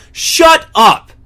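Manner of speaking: high, loud exasperated shout; fed-up outburst. Real, not acted. Fed-up host to complainers, exasperated yell.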